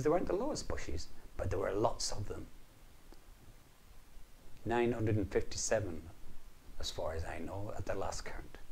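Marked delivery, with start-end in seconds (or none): 0.0-1.1 lowest said much higher; high sing-song. A narrator's reading. Highest versus lowest contrast. 6.8-8.6 whispered aside. A narrator's reading. Aside about bush count.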